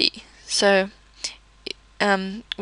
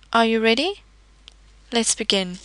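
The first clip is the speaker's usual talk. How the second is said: ordinary talk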